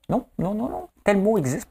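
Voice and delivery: high-pitched mock objection; imitated quibbler over a word; bickering contestant. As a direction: protest in a very high put-on voice. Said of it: "Ils s'assinent des fois avec le".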